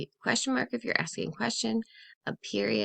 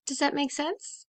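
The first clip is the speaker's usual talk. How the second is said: gasp, then a higher bright question; delighted discovery; 6 semitones higher than usual. Shown as a demonstration. Teacher showing excitement to kids at finding it. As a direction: act out finding the answer.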